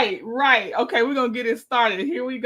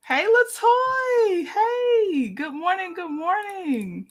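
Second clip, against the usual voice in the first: excited greeting; 7 semitones higher, slower than usual. Real, not acted. Greeting a viewer warmly in chat.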